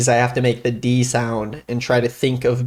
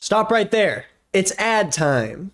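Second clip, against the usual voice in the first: hyped announcer cut-in; 7 semitones higher, slower than usual. Acted. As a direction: call the ad break like a host. Ad break announcement.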